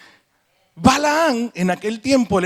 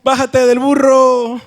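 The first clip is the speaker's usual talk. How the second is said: put-on higher, louder, slow Mexican accent; 6 semitones higher, 8 dB louder, slower than usual. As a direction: stretch it out like a Mexican buddy. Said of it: "Y si fuera mexicano".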